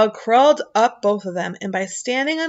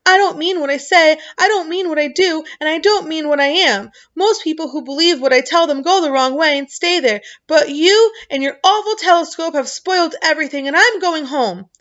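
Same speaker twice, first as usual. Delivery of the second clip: crying; 8 semitones higher, 5 dB louder than usual. A narrator's reading. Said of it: "crying hysterically".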